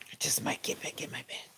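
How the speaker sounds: soft breathy whisper, like a sleepy secret; hushed, unvoiced repeat; whispered aside. Real, not acted. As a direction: whisper it as if tempted back to bed.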